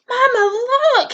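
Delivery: high squeaky little bird voice; squeaky gosling. A narrator's reading. Young gosling calls to his mother as he lifts off, high squeak. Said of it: "he squeaked".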